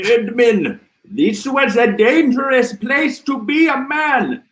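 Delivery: squeaky high comic voice; high silly voice. Pretend. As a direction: pitch it way up for a joke.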